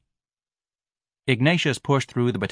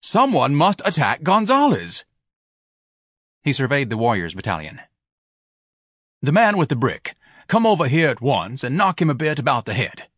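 pompous command; 6 semitones higher, 3 dB louder than usual. Acted. Ignatius commanding.

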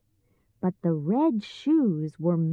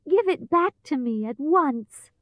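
put-on high, cross little-girl voice; angry demand; 6 semitones higher than usual. A narrator's reading. Dorothy demands shoe from witch, angry. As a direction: demand it indignantly, pitched high. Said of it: "shouted Dorothy angrily".

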